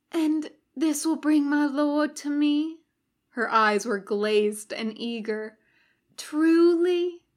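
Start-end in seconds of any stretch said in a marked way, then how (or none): 0.1-2.8 put-on higher, eager young woman's question; eager Satanist girl. A narrator's reading. Satanist woman asking Crowley, lightly voiced eager girl. 6.2-7.2 higher, louder breathless Truly; eager disbelief. A narrator's reading. Satanist woman begging Crowley for confirmation, eager and high.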